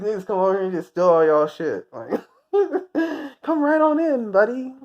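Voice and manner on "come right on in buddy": much higher, sarcastically cheery invitation; mock welcome. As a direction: sarcastically roll out the welcome mat.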